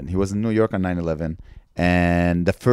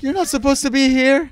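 much higher, louder protest; mock indignant voice; 6 dB louder than usual. Mocking the 9/11 target, high offended squeal.

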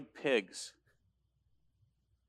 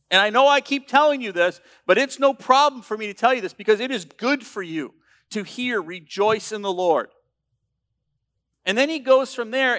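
loud, impassioned pulpit delivery; full-voiced sermon climax, driving each line; preaching at full force; 5 semitones higher, 8 dB louder than usual. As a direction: thunder the warning at the congregation. Preaches the passage loudly.

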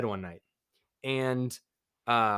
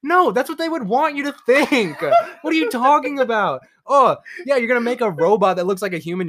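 high, loud laughing rant; 10 semitones higher, 9 dB louder than usual. Real, not acted. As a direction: laugh while arguing your point. Laughing at the idea.

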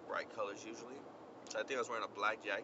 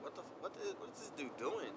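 puzzled mutter; 5 semitones higher, 5 dB quieter than usual. Retold or imitated. Puzzled as a cop passes.

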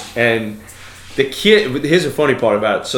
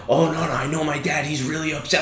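put-on higher, softer panicky kid voice; imitated freak-out; 5 semitones higher, 4 dB quieter than usual. Daughter insists her dad is upset, higher-pitched imitated fretting. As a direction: mimic a worried daughter insisting. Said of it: "like, freaking out".

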